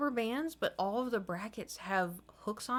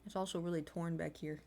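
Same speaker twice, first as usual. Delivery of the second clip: ordinary talk, nothing marked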